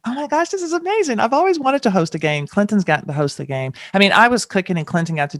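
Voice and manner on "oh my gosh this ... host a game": high, fast gush of delight; retold thrill. To the producers, retelling her joy at hosting, excited gushing. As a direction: squeal it like the dream came true. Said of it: "And I'm like".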